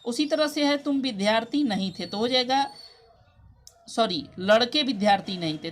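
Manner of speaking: usual speaking voice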